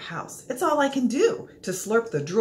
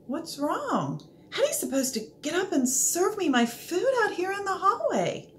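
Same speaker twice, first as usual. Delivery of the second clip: puzzled dog voice; 9 semitones higher than usual. Acted. Dog complains, confused.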